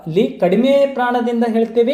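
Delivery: usual speaking voice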